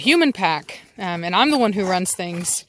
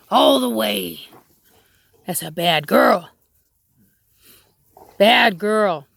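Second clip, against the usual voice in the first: stern correction; slower than usual. Real, not acted. Correcting the dog.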